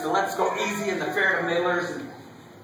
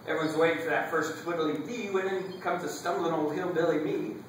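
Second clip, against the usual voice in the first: ordinary delivery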